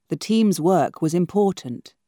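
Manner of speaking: usual speaking voice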